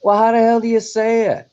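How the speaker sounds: exasperated. Real, not acted. Frustrated at pronunciation.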